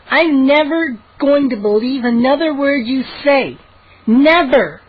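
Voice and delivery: high, indignant vow; snapping. A narrator's reading. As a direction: swear it off crossly. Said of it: "she snapped".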